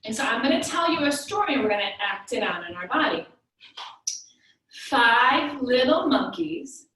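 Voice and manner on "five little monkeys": slow, louder rhyme opening; storytelling to kids. Acted. Parent telling kids a monkey rhyme, slow and storytelling. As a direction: draw the little ones into the story.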